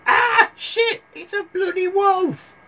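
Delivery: loud, squealing granny falsetto; very high comic granny alarm. Acted. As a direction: cry out as a hammed-up startled granny. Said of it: "the granny went".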